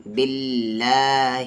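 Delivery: باللہ with a thin, light L, slowly drawn out; light lam. Shown as a demonstration. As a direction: recite باللہ slowly, keeping the double L light. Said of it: "پتلی آواز سے پڑھیں گے". (باللہ کا لام باریک، ٹھہر کر)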